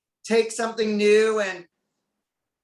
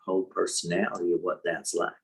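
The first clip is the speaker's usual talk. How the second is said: movie voice; 12 semitones lower than usual. Acted. Shift into lower movie voice.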